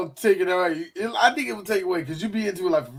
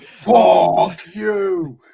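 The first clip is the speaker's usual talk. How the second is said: mock shout; 6 dB louder than usual.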